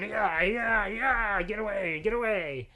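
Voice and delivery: high, loud yelling at a wolf; shouting to scare. Acted. Father charging the wolf with his axe, shouting. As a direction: bellow to drive the beast away.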